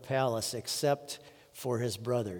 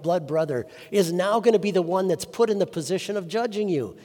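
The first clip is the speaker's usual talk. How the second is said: raised, driving sermon voice; impassioned preaching; 4 semitones higher, 5 dB louder than usual. Real, not acted. Preacher assuring congregation of their brother Christ, impassioned preaching. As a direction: press each phrase home with force.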